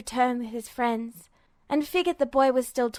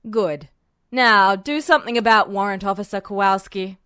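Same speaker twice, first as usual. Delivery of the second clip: low, much louder order; curt general command; 4 semitones lower, 6 dB louder than usual. Acted. General ordering Edward to free the guard, commanding. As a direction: bark out the instruction.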